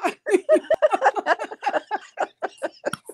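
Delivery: long, breaking fit of laughter; helpless laughter. Real, not acted. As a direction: laugh on and on, unable to stop. Overcome by friend's suffering.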